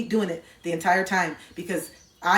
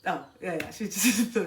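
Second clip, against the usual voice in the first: unbothered partner; 4 dB quieter than usual. Acted. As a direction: murmur it, entirely unfazed. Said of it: "they're just like".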